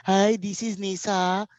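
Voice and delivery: slow, high bad Marathi accent; hammed-up Marathi accent, drawn out. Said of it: "he'll just do a bad Marathi accent".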